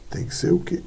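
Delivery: voiceless, soft "o quê"; hushed rhetorical question; whispered question. Shown as a demonstration. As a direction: whisper it to the class. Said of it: "teacher asks class".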